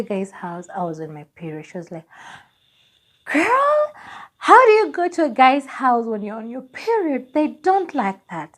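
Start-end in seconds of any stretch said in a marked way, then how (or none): 3.3-8.6 high, loud shocked squeal easing into scolding; scandalized friend. Retold or imitated. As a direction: imitate an appalled girlfriend, pitched way up. Acts out scandalized friend's reaction.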